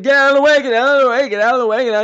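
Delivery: high, loud, frantic repeats; urgent shouting. Retold or imitated. Naked man rushing for the stall, urgent shout. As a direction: bellow it in a mock panic.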